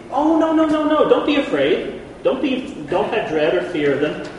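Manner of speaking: high, loud soothing no no; calm a scared people; lightly hammed comfort; reassuring Moses. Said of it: "So I said to you".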